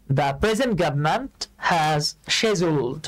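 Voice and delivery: the present government has scheduled, read out very slowly word by word; slow dictation. Shown as a demonstration. Teacher reads the sentence slowly for students to follow. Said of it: "আমরা ব্রিটিশটাকে follow করার চেষ্টা করব".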